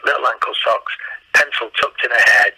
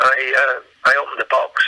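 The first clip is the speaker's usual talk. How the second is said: usual speaking voice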